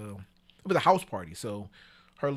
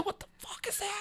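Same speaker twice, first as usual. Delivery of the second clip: shocked exclamation; 10 dB quieter than usual. Retold or imitated. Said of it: "I said".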